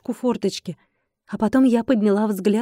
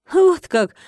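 higher, louder mocking exclamation; smirking; 7 semitones higher, 4 dB louder than usual. A narrator's reading. Darina answers the dragon's news, smirking. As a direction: toss it out with a smirk. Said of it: "усмехнулась Дарина".

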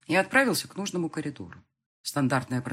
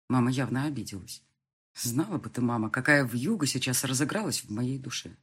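ordinary delivery

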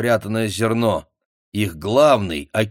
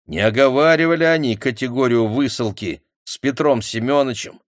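usual speaking voice

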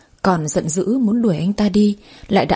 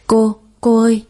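urgent call; 4 dB louder than usual. A narrator's reading. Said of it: "tiếng người gọi gấp gáp".

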